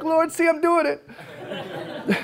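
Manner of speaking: proud laughing aside to the Lord; laughing boast. Retold or imitated. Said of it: "I'm like".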